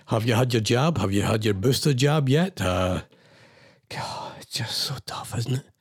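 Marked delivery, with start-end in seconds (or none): 3.9-5.7 soft, slowed whisper; weary whisper. Real, not acted. Host despairing to listeners about the situation, hushed whisper. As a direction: let it slip out, barely voiced, worn down.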